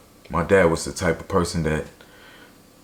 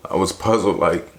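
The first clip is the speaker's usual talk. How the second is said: normal talking voice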